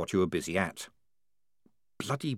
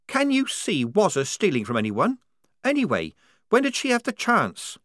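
higher, defensive protest; indignant young soldier girl; 10 semitones higher, 3 dB louder than usual. Acted. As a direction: defend a friend. Polly defending Wazza.

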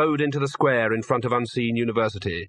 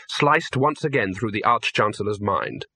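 usual speaking voice

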